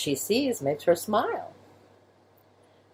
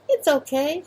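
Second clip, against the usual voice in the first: high mouse voice; 10 semitones higher than usual. Acted. Mice reassuring.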